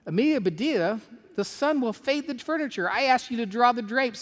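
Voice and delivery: high-pitched acted reproach; exasperated scolding. Employer reproaches housekeeper about the drapes, exasperated scolding. Reader acts Missus Rogers scolding Amelia.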